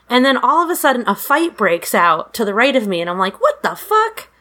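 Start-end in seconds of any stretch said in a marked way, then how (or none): 3.4-4.3 high, startled outburst; startled what the fuck. Retold or imitated. At a rock concert, reacting to a fight breaking out: startled high outburst. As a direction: blurt it out in shock at the fight.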